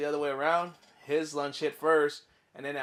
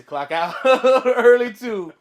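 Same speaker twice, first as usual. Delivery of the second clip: much higher, louder, laughing through the words; 9 semitones higher, 15 dB louder, much slower than usual. Retold or imitated. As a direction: crack up at your own clever plan. Gleeful punchline of own story.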